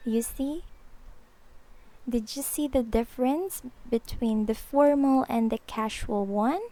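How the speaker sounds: ordinary delivery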